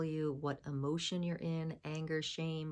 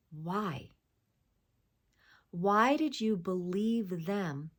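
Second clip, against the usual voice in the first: ordinary talk, nothing marked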